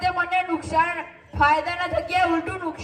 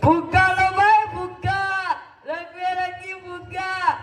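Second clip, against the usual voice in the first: balloon seller's cry. Acted. Balloon seller calling passers-by by name, sing-song hawking. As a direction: chant it out with stretched endings.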